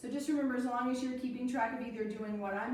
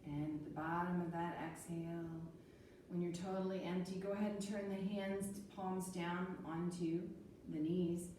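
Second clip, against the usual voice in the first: calm guided breathing; 4 semitones lower, 7 dB quieter than usual. Acted. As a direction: guide the breath gently and calmly. Leads calm breathing practice.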